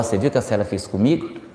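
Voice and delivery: conspiratorial quick murmur behind her back; gossiping aside. Retold or imitated. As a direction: lean in and spill it quickly, softly. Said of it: "Aí depois eu vou lá".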